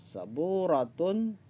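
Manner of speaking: saburatun drawn out, tun clearly sounded; saburatun, each syllable given its time; slow model word. Shown as a demonstration. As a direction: spell out saburatun slowly for the class. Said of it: "tun menunjukkan muannas".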